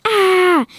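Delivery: high, loud startled "Ah!"; sudden scared cry; yelled ah. A narrator's reading. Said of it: "yelled Roxy".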